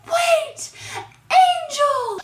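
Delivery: very high, loud mock wail; hammed-up panic as she remembers; mock panicked cry. Blondie suddenly remembering the girl, mock panic. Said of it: "eventually she's like".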